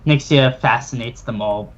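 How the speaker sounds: usual speaking voice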